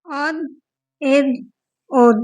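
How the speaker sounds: "اد" with a thick د and a bounce; "اد" with a heavy, full-mouthed د; "اد" said with a full mouth and an echo on د; heavy د with qalqalah. Shown as a demonstration. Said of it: "داد حرف مستعلیہ ہے تو یہاں پر ہم پر".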